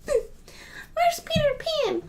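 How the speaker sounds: tiny, very high, drawn-out fairy squeak; mock pixie voice, way up high; Tinkerbell. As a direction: squeak it slowly like a little fairy. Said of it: "I wouldn't be, like, Tinkerbell, twinkling around".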